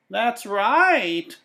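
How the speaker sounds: high, put-on old woman voice; old woman's voice. A narrator's reading. The old woman agreeing with Bickford, high old voice. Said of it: "she said".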